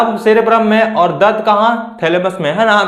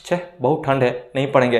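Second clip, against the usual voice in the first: low, quiet grumble to oneself; student backing out under their breath; reluctant student; 7 semitones lower, 7 dB quieter, faster than usual. Acted. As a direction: drop into a soft, low voice, begging off. Voices reluctant student feeling cold at night.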